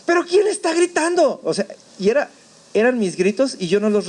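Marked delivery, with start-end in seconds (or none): 0.0-1.4 high-pitched indignant question; bewildered outcry. Retold or imitated. Dazed survivor asks himself who is screaming, bewildered outcry. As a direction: demand it in high-voiced disbelief.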